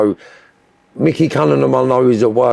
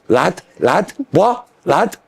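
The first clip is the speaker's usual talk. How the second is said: mock Scouse lad; 6 semitones higher, much slower than usual. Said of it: "they're gonna let you know".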